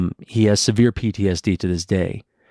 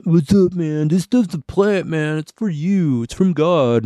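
higher, spaced-out burnout voice; a higher, dopey stoner drawl; stoner dude voice; 8 semitones higher, slower than usual. Retold or imitated. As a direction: play the preachy hippie stoner pushing weed. Mocking the stoner idiots in town.

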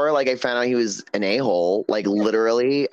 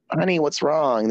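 higher-pitched, concerned sweet talk; caring; 5 semitones higher than usual. Retold or imitated. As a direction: imitate someone gently checking on a friend. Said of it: "Not like".

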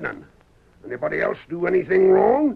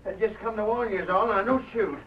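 fearful. Acted. Man begging not to be shot.

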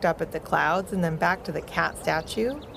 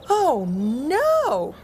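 high, loud alarmed cry; 7 semitones higher, 4 dB louder than usual. A narrator's reading. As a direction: cry out as dogs fall toward you. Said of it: "Taffy cried out".

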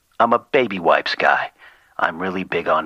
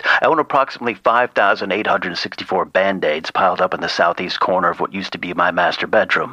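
ordinary talk

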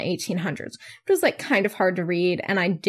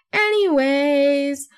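higher, long drawn-out "anyways"; exaggerated self-mockery; 5 semitones higher, 4 dB louder, much slower than usual. Podcaster hamming up her own catchword, high and drawn out. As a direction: sing out the word, stretched and raised.

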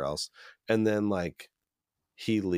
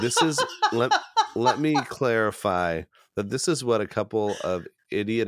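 laughing; 4 semitones higher, 6 dB louder than usual. Real, not acted. Laughing while clarifying joke.

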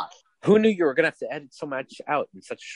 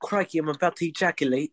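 higher, put-on Aussie catchphrase voice; mock Australian accent; 4 semitones higher, 4 dB quieter than usual. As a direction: ham it up as an Aussie. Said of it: "No, she says, "Crikey".

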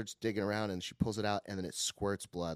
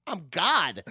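1 triumphant; 7 semitones higher, 9 dB louder than usual. Retold or imitated. Triumph at vending machine.

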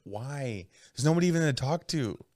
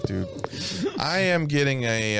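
usual speaking voice

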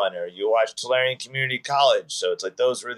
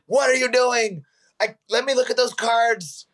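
higher, disbelieving voice; incredulous; 10 semitones higher than usual. Retold or imitated. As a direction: act shocked at the sloppy cards. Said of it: "was like".

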